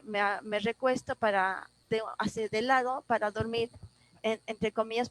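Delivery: ordinary talk, nothing marked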